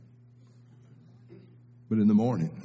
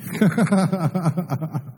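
laughing through tears; a wet, teary laugh; real crying laugh; tearful laugh; 5 semitones higher than usual. Real, not acted. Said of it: "I'm crying right now".

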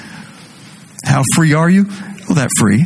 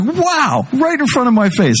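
amazed; 9 semitones higher than usual. Real, not acted. Amazed at the couple's engagement.

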